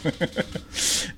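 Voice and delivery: soft unvoiced chuckle; hushed laugh at a friend's crude joke; breathy laugh. Real, not acted. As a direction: laugh quietly under the breath.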